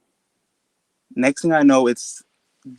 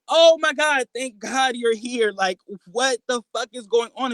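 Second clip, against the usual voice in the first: frantic relief; 11 semitones higher than usual. Retold or imitated. Retold panicked relief at seeing mom.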